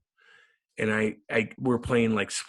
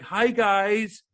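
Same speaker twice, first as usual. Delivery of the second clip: high, a bit louder, eager mock greeting; eager rookie; 9 semitones higher, 4 dB louder than usual. Imitating his rookie self greeting veterans, high and eager. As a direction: chirp it like a nervous kid. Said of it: "I walk in like".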